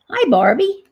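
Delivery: bright, high-pitched hello to a doll; high sweet greeting. Real, not acted. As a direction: sing out a cheery hi.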